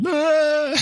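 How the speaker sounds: sudden high, loud mock yelp of a startled kid; imitating his daughter's startled cry; child's yelp. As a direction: squeal as if struck. Child reacts to fake spank.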